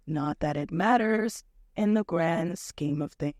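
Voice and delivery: low, gloomy robot drone; Marvin voice. Pretend. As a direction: sigh it out like a depressed android.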